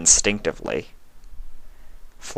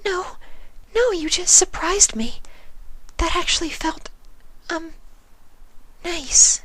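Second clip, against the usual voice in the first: much higher, breathless and bashful; flustered Fluttershy; 3 dB quieter than usual. A narrator's reading. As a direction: reassure him while still startled. Fluttershy reassures the changeling.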